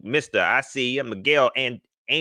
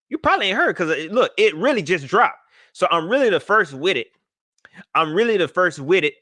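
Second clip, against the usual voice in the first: higher, louder bragging about the scoop; mock hype; 5 semitones higher, 4 dB louder than usual. Bragging to viewers he's first, hyped. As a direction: sell it like breaking news. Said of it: "I'm lying".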